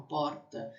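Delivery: porta with a light São Paulo front R. Shown as a demonstration. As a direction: keep the R of porta forward, not in the throat. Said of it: "em São Paulo".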